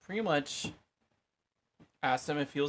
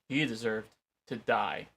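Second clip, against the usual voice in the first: ordinary talk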